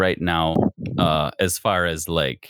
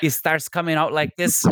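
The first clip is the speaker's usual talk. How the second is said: higher-pitched Mexican-American accent; Mexican Spanish accent; 7 semitones higher than usual. Shown as a demonstration. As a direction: break into an excited Mexican accent. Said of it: "goes right back to that Spanish accent".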